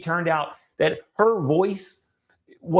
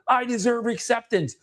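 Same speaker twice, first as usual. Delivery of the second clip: high, emphatic declaration; more assertive; 8 semitones higher than usual. Retold or imitated. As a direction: imitate a client answering with extra intensity. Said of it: "I'm probably being more assertive".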